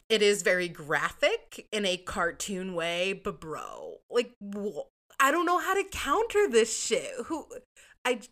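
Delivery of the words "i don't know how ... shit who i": high, louder exasperated outburst; flabbergasted. Real, not acted. Host stunned by a pedophilia-claim review, high and exasperated. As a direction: throw up your hands.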